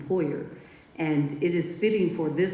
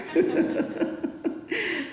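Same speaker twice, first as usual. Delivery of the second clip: real laugh; 13 semitones higher than usual. Real, not acted. Laughs at son's line.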